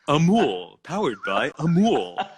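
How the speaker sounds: drawn-out American sponsor read, butchering the Hindi name; butchered American accent. Retold or imitated. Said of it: "like an American, completely butchering".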